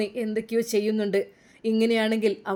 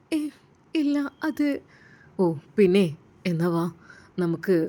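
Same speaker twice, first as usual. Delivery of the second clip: lower-pitched, honeyed husband sweet-talking his wife; coaxing doctor; 5 semitones lower than usual. Acted. (ഭാര്യയെ താഴ്ന്ന ശബ്ദത്തിൽ കൊഞ്ചിക്കുന്ന doctor)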